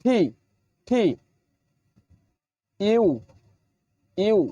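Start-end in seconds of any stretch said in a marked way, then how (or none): 0.0-1.0 ঠি with a breathy hard t; teacher says ঠি with aspirated t; English T. Shown as a demonstration. Teacher models the letter T for learners. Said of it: "টি হবে".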